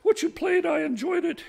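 high-pitched lady paying a compliment; appreciative lady. Acted. Lady compliments the musician on his tune, high and appreciative. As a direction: praise it in a high, ladylike voice.

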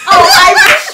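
high, loud shout of a guess; excited blurt. Real, not acted. Player suddenly getting the answer, excited shout.

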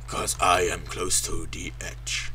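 deep, soft, slow delivery; low slow recital. Acted. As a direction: drop low and quiet, drawing it out. Quotes song after shouting.

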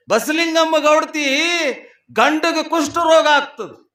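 high, projected sermon storytelling; full-voiced preaching. Real, not acted. Preacher telling devotees of the husband's illness, full-voiced preaching. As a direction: tell the legend out to a big hall.

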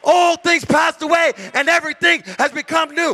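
high, driving sermon voice; preaching at full force. Acted. Pastor preaching scripture to the congregation, full force. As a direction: proclaim it to the whole room.

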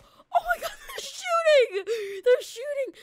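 high, frantic yell with a nervous laugh; panicked shout. Real, not acted. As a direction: shriek it as the bullets fly. Police opening fire during chase.

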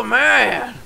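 normal talking voice

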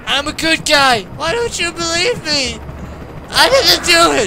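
high, loud pleading yell; shouting up at the helicopter, innocent; desperate shout. Real, not acted. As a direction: beg the chopper not to shoot. Pleading with police chopper.

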